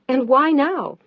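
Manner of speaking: ordinary delivery, nothing marked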